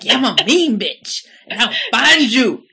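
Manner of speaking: loud, high, hammed-up defiance; playfully exaggerated loud menace; mock threat. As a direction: belt out a tough comeback for laughs. Hams up friend's comeback to follower.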